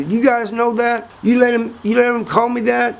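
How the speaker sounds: high-pitched wounded disbelief; indignant protest. Retold or imitated. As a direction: protest to friends who let you be fooled. Acted protest to the laughing men.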